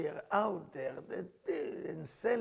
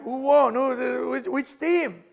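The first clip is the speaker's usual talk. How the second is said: raised, loud questioning shout; mock excited calling; 6 semitones higher, 10 dB louder, much slower than usual. Onlooker asking who won the fight, loud mock calling. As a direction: call out like a confused spectator.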